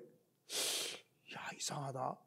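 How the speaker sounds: hushed musing to oneself; soft wondering undertone; puzzled whisper. Retold or imitated. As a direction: whisper it low, mystified. Puzzled child muses to himself.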